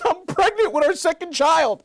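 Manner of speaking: very high, loud mock wail; mock crying wife. Wife breaking pregnancy news to husband, loud mock wailing. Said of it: "she starts crying even more".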